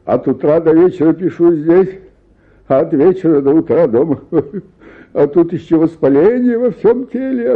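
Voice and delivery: ordinary talk, nothing marked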